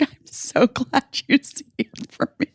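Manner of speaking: high, whispered through tears; tearful whispered gratitude; sobbing thanks. Retold or imitated. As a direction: squeak it out as if crying. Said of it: "sobbed and, like, hugged her".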